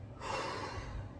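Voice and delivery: long unvoiced sigh of grief; a weary grieving exhale; heavy sigh. Real, not acted. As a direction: let out a heavy breath before going on. Grieving son retelling mother's death.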